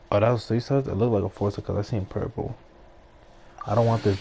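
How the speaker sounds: ordinary talk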